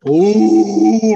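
long howling beast call. Retold or imitated. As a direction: howl it like a big gentle beast. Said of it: "He'd go".

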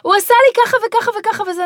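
put-on a child complains to his mother, whining; child tattling. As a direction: tell on your brother like a kid. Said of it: "אחד אומר לי".

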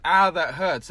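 a loud mock wince of ah, that hurts; mock Simba. Simba hit on the head by Rafiki, loud mock wince. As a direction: act out Simba getting hit. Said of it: "and he goes".

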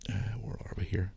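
whispering to himself while searching; breathy, unvoiced mutter; whispered aside. Real, not acted.

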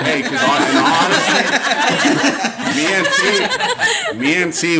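breathless, laughing high; winded and giggling; out of breath. Real, not acted. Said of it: "we're out of breath too".

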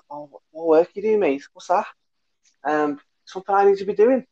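high-pitched, clueless Scouse lad; Scouse accent. Retold or imitated. Clueless Scouse lad asking what the work is, in Scouse accent. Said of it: "that was my best scouse accent".

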